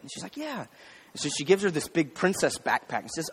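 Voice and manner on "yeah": put-on little girl. Girl answers her mother.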